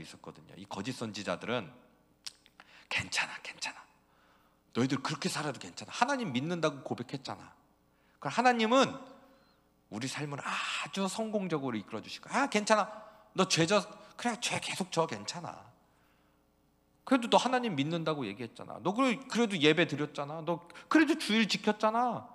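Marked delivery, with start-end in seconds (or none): 2.9-3.8 breathy whisper, coaxing; false prophet whispering reassurance; whispered soothing. Acted. 4.7-22.3 high, coaxing reassurance; false prophet telling people sin is fine; smooth false prophet. Acted.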